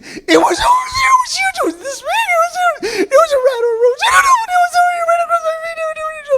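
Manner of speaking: very high, loud, panicked shrieking babble; panicked scream. Retold or imitated. Wife on the sink screaming at her husband about a rat, panicked. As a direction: mimic a terrified scream at a rat. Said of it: "begins to scream".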